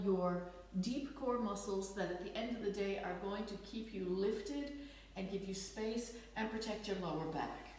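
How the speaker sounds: ordinary talk, nothing marked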